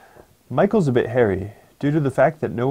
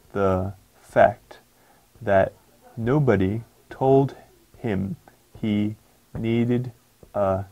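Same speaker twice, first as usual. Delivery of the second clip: word by word, slow, with long gaps; read slowly; much slower than usual. Shown as a demonstration. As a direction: dictate each word apart. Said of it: "I said it differently two times".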